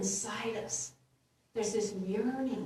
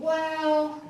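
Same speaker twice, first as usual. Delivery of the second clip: higher, louder, drawn-out "well"; mock reluctance; 6 semitones higher, 6 dB louder than usual. Congregant disliking the guidance we get, mock reluctant. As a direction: balk politely at unwelcome advice. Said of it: "And we think".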